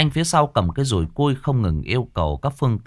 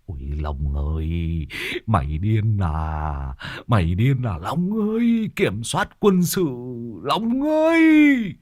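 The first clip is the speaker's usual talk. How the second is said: panicked pleading; 4 semitones higher than usual. Acted. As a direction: beg him in terror to pull over. Passenger panics, begs driver. (giọng hoảng hốt van xin)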